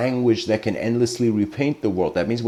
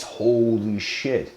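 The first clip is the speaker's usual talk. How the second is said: slow, stunned mock shock; hammed-up holy-shit moment; stunned realization; much slower than usual. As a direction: let the realization land slowly. The disciple's sudden realization.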